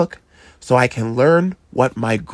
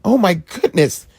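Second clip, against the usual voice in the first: disbelief; 8 semitones higher than usual. A narrator's reading. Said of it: "in disbelief".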